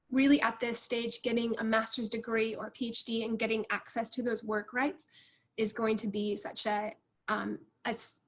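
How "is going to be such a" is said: ordinary talk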